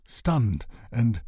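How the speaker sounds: usual speaking voice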